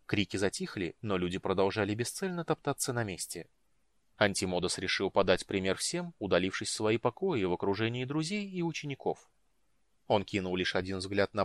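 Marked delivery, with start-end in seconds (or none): none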